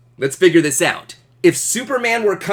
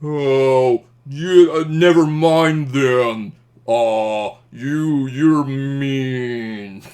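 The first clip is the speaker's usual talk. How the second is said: a hint of a sulky, hurt voice; hurt Superman; much slower than usual. Superman flying away with hurt feelings, lightly sulky. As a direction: mope it as you fly off. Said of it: "he would be like".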